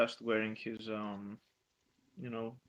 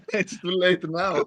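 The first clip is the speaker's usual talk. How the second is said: laughing; 6 semitones higher, 14 dB louder than usual. Real, not acted.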